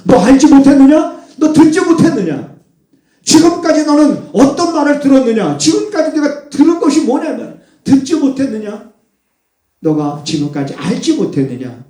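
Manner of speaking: high, loud reproach; rebuking cry. Acted. Preacher rebuking the congregation in God's voice, loud. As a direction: thunder the challenge at the listeners.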